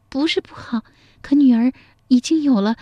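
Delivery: put-on high young woman's voice; young daughter pleading with her mother, voiced high; daughter's voice. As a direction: lift into the shy daughter.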